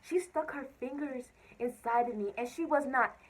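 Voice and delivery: loud, high outraged rant; indignant. Real, not acted. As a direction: blast the injustice. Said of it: "I was mad".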